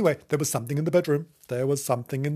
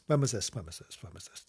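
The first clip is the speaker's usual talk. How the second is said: musing, dropping to a murmur; fading away; 7 semitones lower, 12 dB quieter than usual. Real, not acted. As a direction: repeat it softer and lower each time until gone. Fading repeat to nothing.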